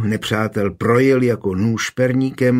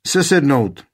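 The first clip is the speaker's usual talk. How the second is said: higher barked command to dismount; shouted order; 4 semitones higher, 3 dB louder than usual. Acted. Captain orders his men to dismount with a raised shout. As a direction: order the men off their horses. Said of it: "křikl Jan Talafus".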